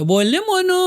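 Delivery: high meek reply; meek husband. Retold or imitated. Husband answers his wife meekly, high and sweet. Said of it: "પેલો એ હામો".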